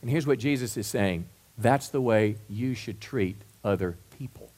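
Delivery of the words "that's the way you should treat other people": lower, restrained delivery with pauses between words; measured statement. Real, not acted. Preacher giving the congregation Jesus's teaching, measured and restrained. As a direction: set the teaching down gently, word by word.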